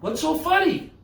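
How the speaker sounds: high-pitched playful questioning; mock puzzlement. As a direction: act baffled by the laughter, voice up high. Mock puzzlement at laughing kids.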